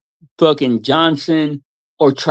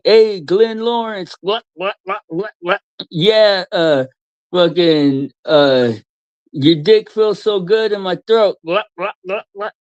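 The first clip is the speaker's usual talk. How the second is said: higher mocking suck-up voice; mocking impression; 4 semitones higher than usual. Mocking the streamer fawning over Glenn Lawrence. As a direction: sneer it like a fawning fan. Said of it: "And then you're like".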